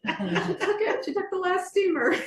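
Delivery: high, laughing mock disbelief; a laughing shopper outraged the last steamer is gone; mock outrage. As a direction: squeal it like you were robbed of the steamer. Mock outrage over lost steamer.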